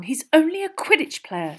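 impatient girl playing it down; exasperated dismissal. A narrator's reading. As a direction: brush it off impatiently with a falling tune. Hermione's dismissive take, echoed after.

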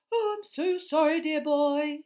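a lightly voiced vague, fluttery professor; dreamy. A narrator's reading. Professor waking from her trance apologizes to Harry, dreamily. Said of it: "she said dreamily".

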